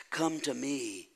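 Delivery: soft, low-key gentle invitation; comforting. Acted. Preacher voicing Jesus calling the burdened, softly comforting. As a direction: drop the volume and invite them tenderly. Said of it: "calls us and comforts us".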